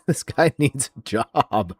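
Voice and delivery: deadpan, slow scorn on "This guy needs a job"; slow mocking repeat. Real, not acted. Repeating it slowly to mock the YouTuber, scornful.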